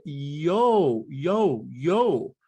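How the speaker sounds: "yo" for "your", slow and drawn out; dropped R. Shown as a demonstration. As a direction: stretch each "yo" separately. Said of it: "I would say".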